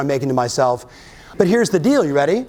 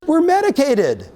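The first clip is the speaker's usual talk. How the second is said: shocked reaction; 11 semitones higher than usual. Retold or imitated. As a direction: blurt it out in disbelief. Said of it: "I was like".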